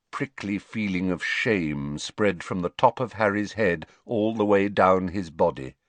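usual speaking voice

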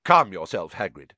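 firm, loud first word, then down; sharp. A narrator's reading. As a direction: cut him short sharply. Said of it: "said Dumbledore sharply".

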